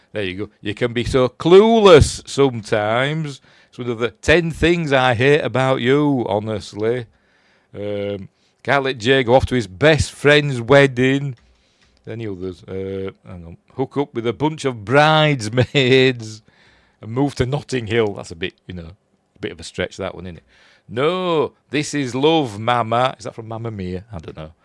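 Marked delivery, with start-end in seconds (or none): 0.6-11.4 hammed-up scolding monologue. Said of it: "listen to me, she does this now". 13.7-18.1 slowed, louder mock pep talk; hammed-up monologue. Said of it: "listen to me, she does this now". 20.9-23.1 mock dramatic. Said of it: "listen to me, she does this now".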